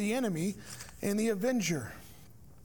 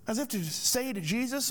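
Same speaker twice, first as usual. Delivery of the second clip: usual speaking voice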